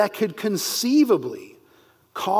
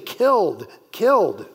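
both KILLED hit hard and loud, each falling; emphatic repetition; 3 dB louder than usual. Shown as a demonstration. Teacher showing the Hebrew doubling, both killed hammered. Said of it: "It's very, very emphatic here".

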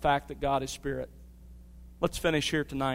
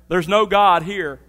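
mock declaration; 3 semitones higher, 8 dB louder, slower than usual.

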